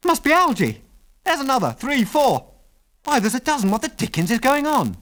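very high, astonished exclaiming; excited amazement. A narrator's reading. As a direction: exclaim in rising wonder, pitched right up. Excited sighting of lights in sky.

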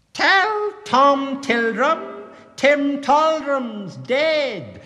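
high, loud, very slow squeak; squeaky cat voice. A narrator's reading. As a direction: deliver the message as an eerie squeaky cat. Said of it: "kind of a squeaky voice".

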